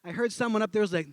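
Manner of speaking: normal talking voice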